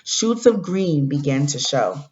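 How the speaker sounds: storybook read-aloud to the class; reading to children. Acted. Teacher reading a story to fourth graders, gentle read-aloud. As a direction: read it like a picture book for kids.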